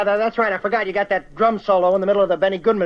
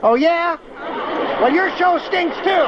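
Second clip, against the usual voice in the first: indignant retort; 7 semitones higher, slower than usual. Acted. Caller insults his show.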